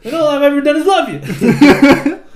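high, loud, hammed-up hurt whining; Donkey. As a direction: complain like a wounded cartoon sidekick. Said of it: "She's like donkey".

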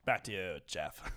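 low, slow news anchor sign-off. Acted. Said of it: "my anchor voice".

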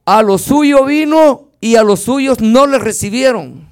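preacher reading scripture with force; loud scripture reading. Acted. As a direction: declaim the verse to the church. Reading John aloud from the pulpit.

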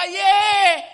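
loud, high cheer; joyful yell. Retold or imitated. Preacher imitating a joyful crowd's cry, loud cheer. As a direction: shout it like a happy crowd. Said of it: "Sabi nung iba".

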